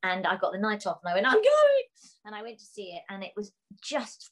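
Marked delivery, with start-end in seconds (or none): 1.3-1.8 high, loud squeal of delight; excited exclamation. Retold or imitated. Actress getting the night off for the show, excited squeal. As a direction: shout it out, thrilled, way up high.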